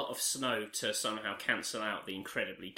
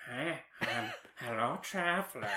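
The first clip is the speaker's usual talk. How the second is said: lightly voiced cat person greeting; a hint of a cliché cat character; Khajiit cat voice. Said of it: "The Khajiit are all like".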